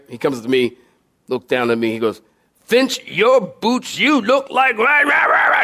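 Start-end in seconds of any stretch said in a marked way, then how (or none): 2.7-5.6 drawn-out higher-pitched chewing-out; mock drill-sergeant yelling. Gunny berating Finch at boot inspection, mock yelling. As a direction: bellow into garbled rah-rah noise. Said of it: "He starts, like, yelling in my face".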